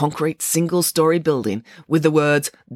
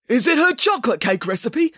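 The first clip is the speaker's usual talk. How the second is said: raised voice from the back of the room; older journalist calling out; 8 semitones higher than usual. A narrator's reading. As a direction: call the question out, pitched up. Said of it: "called a heavy-set older journalist from the back".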